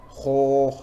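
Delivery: Kho with an open mouth and heavy throat sound; thick throaty Kho. Shown as a demonstration.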